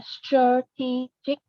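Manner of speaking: "strategic" slowly as struh-TEE-jik; shown pronunciation. Shown as a demonstration. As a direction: model "strategic" syllable by syllable, stressing TEE. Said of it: "Cái này đọc là".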